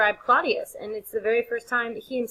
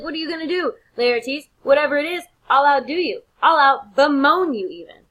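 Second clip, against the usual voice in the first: a brash, shouted brag; taunting shout; 7 semitones higher, 9 dB louder than usual. Retold or imitated. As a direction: goad Laertes loudly, one boast after another. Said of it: "And he's like".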